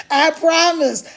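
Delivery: ordinary talk, nothing marked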